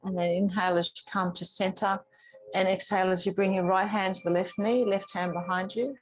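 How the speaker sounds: normal talking voice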